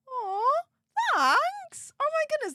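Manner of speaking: high, loud, touched aw of thanks. Real, not acted. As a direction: melt at the kind message. Touched by a viewer's message.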